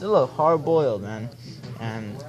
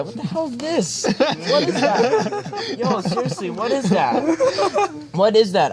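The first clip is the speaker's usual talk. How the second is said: disbelief; 11 semitones higher than usual. Real, not acted. Shocked at the picture.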